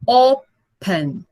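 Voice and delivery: "Open" syllable by syllable, falling at the end; two separate syllables. Shown as a demonstration. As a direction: Open broken into two slow beats, first strong. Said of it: "ele tem duas sílabas".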